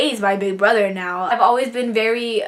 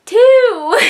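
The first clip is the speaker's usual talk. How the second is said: high, loud mock outrage: Two!; exaggerated outrage; 12 semitones higher, 9 dB louder than usual. Real, not acted. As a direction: shout the word Two in disbelief. Only two letters, exaggerated.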